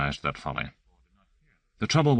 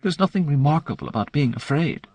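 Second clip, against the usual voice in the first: angry whisper; 9 semitones higher than usual. A narrator's reading. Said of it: "virtually hissing through clenched teeth".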